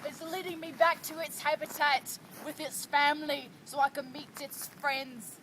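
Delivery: soft, hushed wildlife narrator; nature documentary. Pretend. Documentary narration about the goose.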